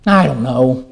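low, defeated husband's answer; stumped husband. Acted. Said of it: "she says to her husband".

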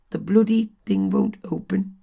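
a failed Scouse accent, high and loud; bad Liverpool accent. Shown as a demonstration. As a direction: attempt a Liverpool accent and miss badly. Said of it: "Or if he was from Liverpool, that would be".

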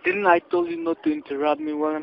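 high, indignant rebuke; fed-up telemarketer snapping back; irritated scolding. Real, not acted. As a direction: scold him like a strict boss. Scammer scolds caller for interrupting.

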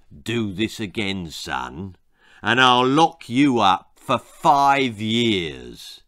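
low, slow, heavy warning; stern policeman threat. A narrator's reading. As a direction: lean in and threaten slowly.

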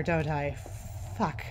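normal talking voice

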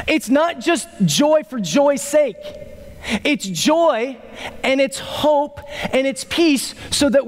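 high-pitched, driving sermon delivery; impassioned preaching. Real, not acted. Preacher telling congregation what joy is for, impassioned. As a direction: preach it with urgency, punching key nouns.

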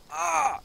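a sudden high, loud yelp of pain, hammed up; mock pained yelp. The first guy, in pain from the grape, lets out a mock high yelp. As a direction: yelp like it hurts, played for the joke. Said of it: "and he says".

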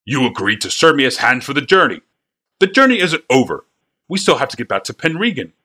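an apoplectic boss scolding his hands; furious wagon master. Acted. Furious wagon master berates boys.

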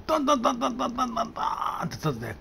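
normal talking voice

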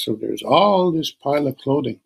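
plain reading voice

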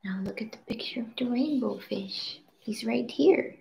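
soft unvoiced whisper. Real, not acted. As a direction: breathe it, hushed. Whispering to child over book.

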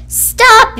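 high, loud stop; yelled. A narrator's reading. Little Blue yelling at honking cars to stop, loud high. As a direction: shout stop at the traffic. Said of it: "yelled Blue".

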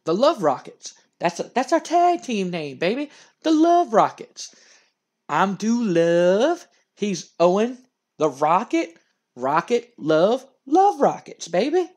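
strut through it like a flashy wrestler; cocky Doolove voice. Retold or imitated. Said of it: "He's like".

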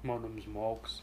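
echoing a low, soft hero impression; Mad Max. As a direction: copy the quiet, deep character voice. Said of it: "Let's see how Mad Max talk".

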